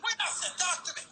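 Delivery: high surprised interjection. Acted.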